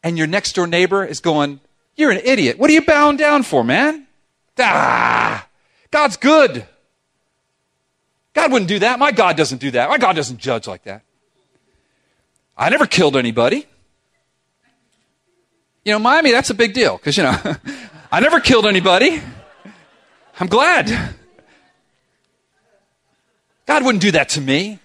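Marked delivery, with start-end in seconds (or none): 1.9-11.0 high, loud mocking scoff at a fool; hammed-up scornful neighbor, pitched high. 12.6-24.8 smug sinner. Acted. Self-righteous sinner voiced.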